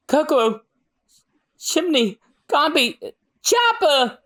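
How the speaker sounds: high cries climbing name by name; anguished calling out. Acted. Calling out lost companions' names.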